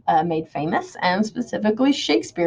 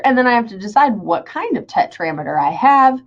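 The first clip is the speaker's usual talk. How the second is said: ordinary talk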